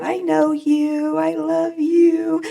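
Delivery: high, very slow and tender. Retold or imitated. Mother speaking to her newborn son, slow tender coo.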